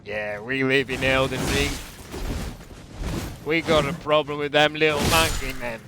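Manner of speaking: mock Australian local; wobbly put-on Aussie accent. Australian local complaining about monkey men, mock Aussie accent. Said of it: "Hope that's not Australian".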